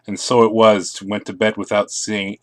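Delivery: usual speaking voice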